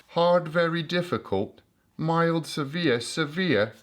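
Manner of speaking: hammed-up British accent, deliberately poor; jokey bad British accent. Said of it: "That's the worst accent I've ever done".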